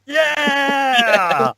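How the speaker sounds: high, bright cheer; mock cheer. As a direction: cheer it out like a fan. Cheering the cure.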